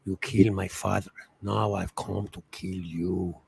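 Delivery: low, slow, soft menacing movie line; film quote. Retold or imitated. Quoting Princess Bride to his co-host, low and slow. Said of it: "Princess Bride".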